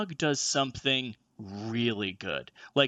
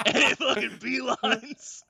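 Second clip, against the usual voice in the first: laughing while telling; 10 semitones higher, 3 dB louder, slower than usual. Real, not acted. Laughing through the punchline.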